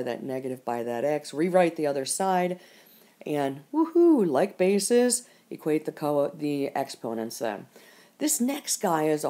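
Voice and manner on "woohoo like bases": higher, louder woohoo of mock triumph; playful cheer. Real, not acted. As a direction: give a drawn-out little cheer, pitched up. Teacher cheers equal bases.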